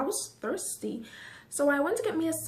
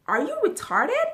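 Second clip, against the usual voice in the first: angry question; 10 semitones higher, 5 dB louder than usual. Retold or imitated. That's what I'm asking her.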